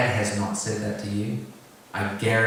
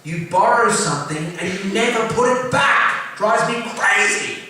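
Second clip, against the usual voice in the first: much higher, louder mock dad griping, slowing at the end; imitating dad's complaint; 12 semitones higher, 7 dB louder than usual. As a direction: play the fed-up father whining about borrowed things. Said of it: "he said things like".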